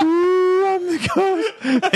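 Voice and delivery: very high, slow wailing ghost; kid's ghost voice.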